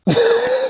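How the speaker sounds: high startled gasp; quick shocked intake of breath; sharp gasp. Real, not acted. Sudden alarm.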